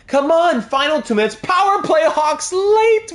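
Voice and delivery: pleading with the team as time runs out; anxious excitement. Real, not acted. Streamer urging his team through the final minutes, rising anxious excitement.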